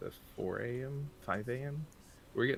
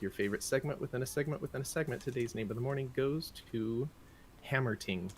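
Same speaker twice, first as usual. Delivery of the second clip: fast, higher showy award intro; award announcer; 4 semitones higher, faster than usual. Acted. Host crowning the name of the morning, showy announcer. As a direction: build it like an award presenter.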